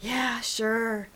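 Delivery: weary, exasperated agreement. A narrator's reading. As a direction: give in with mild exasperation. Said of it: "I blew out a breath of exasperation".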